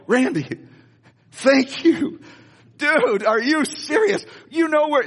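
high, choked-up thanks to his friend; overwhelmed gratitude. Retold or imitated. Pastor thanking Randy at the stadium, high and choked up. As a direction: thank him like you can hardly believe it.

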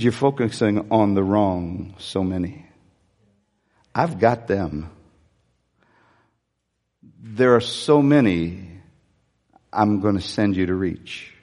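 low, calm, gentle correcting voice; voice of God. Retold or imitated. God gently correcting the pastor, low and calm. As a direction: speak it as a patient father. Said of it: "He said".

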